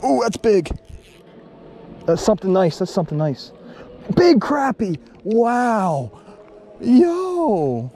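high, loud delighted outbursts; real thrill, pitch way up; excited. Real, not acted. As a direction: cheer the big fish as it comes up. Landing a big crappie.

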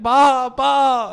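very high, loud mock wail; crying kid. Teller mimics a kid who keeps knocking, loud wailing imitation. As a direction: bawl it like a small child. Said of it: "He's, like, crying".

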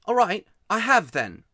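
put-on loud, high miserable boy. A narrator's reading. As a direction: burst out as a boy past caring. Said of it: "in a much louder voice".

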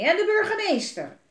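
loud angry ranting; furious alderman ranting to his kids. Acted. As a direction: rant about the headlines. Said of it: "Hij was woedend".

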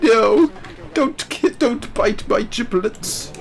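high, whimpering, slowing plea of prey; panicked mock plea. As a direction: beg like a creature about to be eaten, half crying. Voicing a panicking prey animal.

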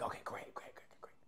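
quick whispered approval, imitating the crew; mock hushed approval. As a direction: whisper it as a rapid-fire aside. Retold crew's hushed approval.

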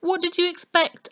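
high, loud mocking question; scornful sneer. A narrator's reading. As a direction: jeer at their naivety. Policeman mocking the couple.

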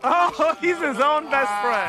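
high, loud delighted cry; delighted laughing. Acted. Friend watches him shake his own hand, delighted laughing. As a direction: burst out laughing, drawing the words out.

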